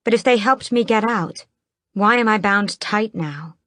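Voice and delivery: ordinary delivery, nothing marked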